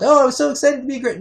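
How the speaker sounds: fast, very high sing-song mimicry; mock her fake elation; mimicking mom's gushing, high and quick; mocking fake excitement. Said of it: "pander to somebody's ego with".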